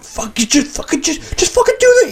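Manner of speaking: high, frantic panicked shout; Warren flipping out. Retold or imitated. Warren panicking at the heist, high frantic outburst. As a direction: squeal it out in a panic. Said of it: "flipping out".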